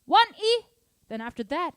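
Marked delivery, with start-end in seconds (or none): 0.1-0.7 "one" and "E" both high and loud; both accented. Shown as a demonstration. Drum teacher showing both accents, loud sung syllables. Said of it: "and then both accents".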